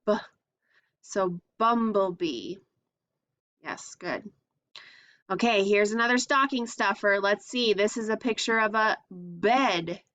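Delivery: ordinary talk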